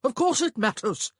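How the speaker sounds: loud, high, indignant protest; voice rising. A narrator's reading. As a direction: raise the voice in indignation. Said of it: "his voice rising".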